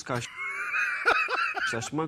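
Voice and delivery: laughing. Real, not acted. Presenter breaks into laughter.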